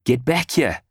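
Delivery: a yell acted within storytelling; narrated yell. A narrator's reading. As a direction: call out in protest, stopping short of a full scream. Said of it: "I yelled".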